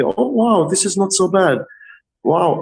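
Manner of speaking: imitated client, high-pitched wonder; pleasant surprise. Tattoo artist voicing clients mid-tattoo, pleasantly surprised. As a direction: mimic a pleasantly surprised customer.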